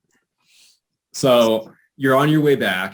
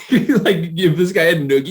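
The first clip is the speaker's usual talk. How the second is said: laughing question; 9 semitones higher, 4 dB louder than usual. Real, not acted.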